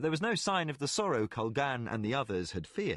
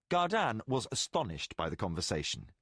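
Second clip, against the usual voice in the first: plain reading voice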